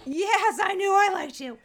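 high, loud, delighted outburst; real delight. Real, not acted. Woman finding a fellow rabbit in Chinese astrology, delighted. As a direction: exclaim it with high-pitched glee.

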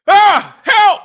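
loud, high yelp for help; cry for help. Real, not acted. Suffering host calls to anyone, cry for help. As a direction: shriek out for rescue.

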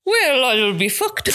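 whale, oil, beef, hooked run together in a rough Irish accent, laughing; laughing Irish try. Shown as a demonstration. Host tries the Irish trick fast, laughing and half-shouting. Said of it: "say it to yourself really fast".

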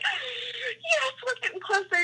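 high, hushed squeal of glee; soft high-pitched excitement; retold excitement. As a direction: squeal it softly, thrilled to be closing in. Retold thrill at hearing elk.

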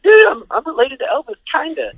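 very high, excited squeak; imitated kid's boasting. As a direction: brag to your friends like a thrilled kid. Acts out excited boasting to friends.

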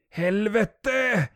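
high, loud shouted curse; horrified scream. A narrator's reading. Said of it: "skriker Alexander". (högt, chockat skrik)

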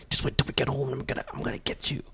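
quiet threatening whisper; whisper yelling. Shown as a demonstration. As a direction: threaten in a hushed voice. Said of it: "getting trouble in church kinda yelling".

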